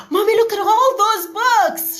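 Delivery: put-on high, loud, thrilled child's voice; mother voicing her daughter's delight at a shelf of books; excited little girl. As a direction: gush like a kid who just spotted treasure. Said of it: "She was that excited".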